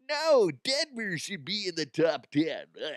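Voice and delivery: high-pitched put-on whining; mock a sore loser's protest, ending in a groan; mocking whiny complaint. Mocking upset listeners' complaint.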